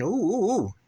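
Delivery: hammed-up high cooing, mocking the fuss; mock swooning ooh. Mocking Americans swooning over a British actor's accent, high cooing ooh. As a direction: tease them, coo like a dazzled fan.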